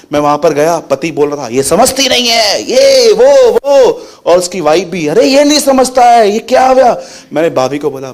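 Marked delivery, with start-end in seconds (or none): 1.5-4.1 mocking angry husband. Imitating husband in a quarrel. 5.1-7.0 high, louder quarrelling wife; mocking angry wife.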